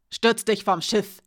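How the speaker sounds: cutting, loud jab; bissig. Acted. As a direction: spit it out with a bite. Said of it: "sagte Alina bissig". (bissig und laut)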